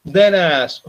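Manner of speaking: ordinary talk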